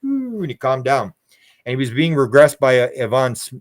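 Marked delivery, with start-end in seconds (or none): 0.0-0.4 high "ooh" like air escaping; deflating balloon. Retold or imitated. Man calming down like a pricked balloon, imitated deflating. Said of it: "put a pin in a, in a balloon".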